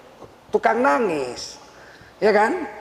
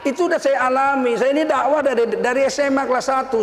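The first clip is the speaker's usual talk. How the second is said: ordinary talk, nothing marked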